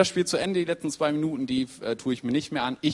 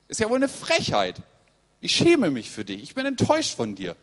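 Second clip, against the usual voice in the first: higher-pitched, insistent acted scolding; indignant telling-off; 5 semitones higher, 3 dB louder than usual. Imagined Jesus scolding doubting Thomas, indignant telling-off. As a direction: give him a piece of your mind. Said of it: "mal so richtig die Meinung gesagt".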